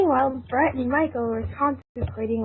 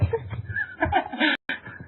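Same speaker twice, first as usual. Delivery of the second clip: gleeful laugh; 11 semitones higher than usual. Acted.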